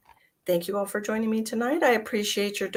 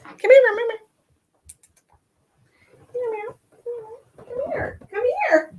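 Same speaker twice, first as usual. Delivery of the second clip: high sing-song kitty call; calling the cat; 4 dB louder than usual. Real, not acted.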